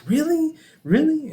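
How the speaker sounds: high-pitched surprise. Retold or imitated. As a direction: jump way up in pitch, questioning. Customer's delighted reply to compliment.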